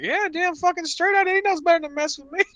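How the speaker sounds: high, fast swaggering boast; cocky brag. Retold or imitated. Narrator bragging to his buddy, high, fast and cocky. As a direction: brag like a tough guy.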